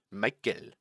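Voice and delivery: mock Austrian boss, understated; quiet Austrian impression. Streamer repeats Toto's radio line in a soft Austrian accent. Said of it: "I will try and do, uh, the accent".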